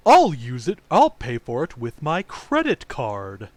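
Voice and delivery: slow, exaggerated American tourist voice; mock American accent. Quoting South Park's Japanese businessmen mocking Americans, slow mock accent. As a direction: ham up a dumb American, slowly. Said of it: "making fun of Americans going".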